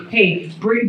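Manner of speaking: ordinary talk, nothing marked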